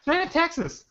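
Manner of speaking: proud mock Texan; Texas accent. Joking with friends in a Texas accent. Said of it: "that's a great Texas accent".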